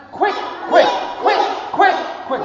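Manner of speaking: high, loud quick quick; duck saying quick. Acted. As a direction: squawk it like an excited duck. Fifth duck says quick.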